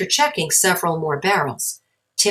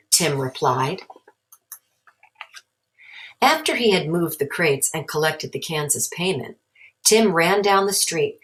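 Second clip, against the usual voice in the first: plain reading voice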